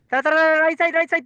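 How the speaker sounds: loud call to charge; loud rallying call to teammates; rallying shout. Real, not acted. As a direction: shout to get the squad moving. Rallying team to push.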